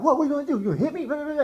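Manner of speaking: loud, high mocking taunt; mimicked goading. Wife daring husband to react, imitated loud, high and taunting.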